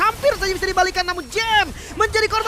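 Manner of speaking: screaming high hype call; esports caster. Real, not acted. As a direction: scream it as the team wipes.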